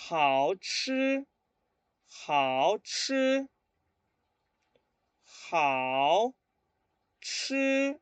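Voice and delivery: 示范好吃的声调; Mandarin tones. Shown as a demonstration. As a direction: model "hao chi" for the class. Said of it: "Muy bien".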